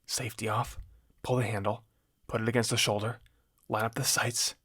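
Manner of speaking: very soft, under the breath; inner recital. A narrator's reading. Shooter recalling gun lessons in their head, hushed. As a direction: recite the steps quietly to yourself. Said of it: "I played what Mandy had shown me about shooting a gun in my head".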